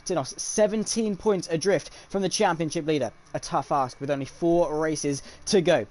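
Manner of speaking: loud, punchy race commentator delivery; motorsport broadcast intro. Acted. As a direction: announce the race night with punched key words.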